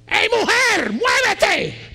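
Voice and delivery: loud, high imitated yell at wife; mock yell. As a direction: bark it like a bossy husband. Child copies father yelling. (niño imitando al padre gritando a su esposa)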